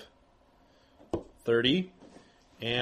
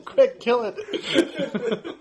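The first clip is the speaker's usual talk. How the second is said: laughing urgently; 10 semitones higher, much slower than usual. Acted. GM urges laughing, in character.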